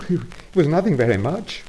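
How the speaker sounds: high, sheepish excuse; sheepish Toad. Acted. Toad sheepishly excusing himself to the engine driver. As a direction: brush it off with embarrassment. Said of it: "said poor Toad".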